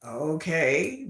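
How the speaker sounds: low, soft, withdrawn okay; shut down. Acted. Introvert among strangers, soft withdrawn reply. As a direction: answer small and awkward, acting it out. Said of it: "like, shut down".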